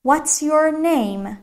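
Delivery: very slow, drawn-out English question; careful teacher's stretched-out demonstration; slow model question. Shown as a demonstration. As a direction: model the question slowly for learners.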